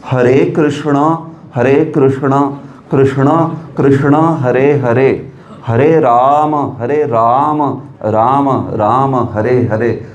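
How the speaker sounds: slow, precisely articulated mantra recitation; painstakingly correct mantra. Acted. Pandit showing how the mantra should be said, painstakingly slow. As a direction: draw out every syllable like a fussy pandit. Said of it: "ऐसे मंत्र बोलना चाहिए".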